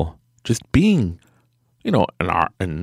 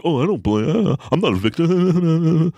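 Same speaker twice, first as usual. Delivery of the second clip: lightly raised, sarcastic whiny voice; mock denial; 10 semitones higher than usual. Speaker mocks an oversensitive viewer, in a sarcastic whine. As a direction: parody the viewer who insists they're not a victim. Mocking a self-pitying viewer.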